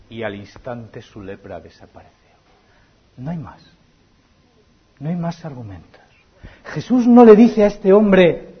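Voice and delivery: ordinary talk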